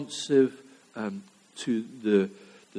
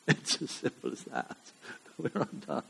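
laughing; 10 dB quieter than usual. Real, not acted. Laughs while restating the point.

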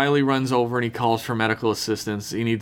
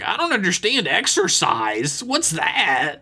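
higher-pitched mock clueless whine; lightly mocking, raised voice; mocking Riley; 6 semitones higher than usual. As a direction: play him clueless, pitched up. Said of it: "And Riley's like".

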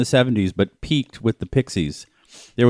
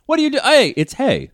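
British bloke voice; 11 semitones higher than usual. Pretend. British call-out impression.

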